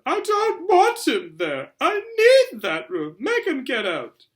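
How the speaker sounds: very high, loud, slow wail; bawling. Acted. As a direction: wail like a spoilt child in a tantrum. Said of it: "Dudley bawling".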